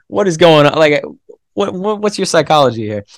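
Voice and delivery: mock disbelief. Asking Brian how he qualifies, mock amazement. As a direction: act amazed at an elite runner's results. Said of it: "you're like".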